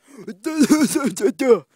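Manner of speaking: high, rapid burst of real laughter; loud giggling laugh. Real, not acted. Cracks up at own remark.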